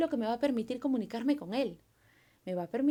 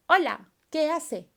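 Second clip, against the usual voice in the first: chat message; 5 semitones higher, 8 dB louder, slower than usual. Retold or imitated. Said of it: "Yo te pongo".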